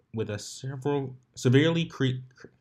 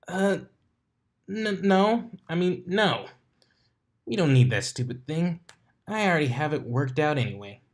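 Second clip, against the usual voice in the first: higher, stammering flustered denial; 7 semitones higher, 3 dB louder than usual. Acted. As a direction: stumble then rush to cover it up. Dash flustered, covering up.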